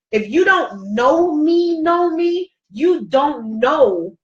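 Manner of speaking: "know me, know me" stretched out and pitched high; slow, high insistence on "know me", dropping on "you don't know"; emphatic stress. Real, not acted. Speaker telling viewers they don't know her struggle, emphatic stress on "know me".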